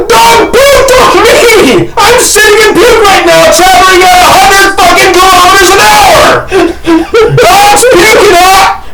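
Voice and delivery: high, screamed rant; hysterical yelling. Retold or imitated. Acts out yelling in the car.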